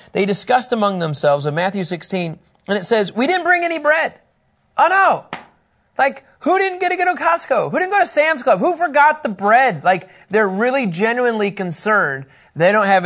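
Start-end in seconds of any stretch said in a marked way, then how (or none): 3.1-4.1 worried disciples. Retold or imitated. Disciples worried about bread. 4.8-9.8 mock panic. Mock panic over missing bread.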